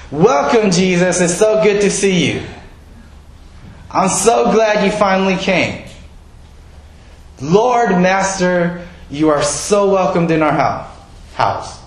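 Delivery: high, bright-voiced, eager greeting of a dear guest; a hammed-up hostess overjoyed to see Jesus; gushing welcome. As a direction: throw the door open and gush over him. Martha's imagined ideal welcome.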